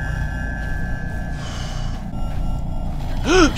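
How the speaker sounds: long sharp gasp of fright; frightened gasp. Real, not acted. Sudden scare.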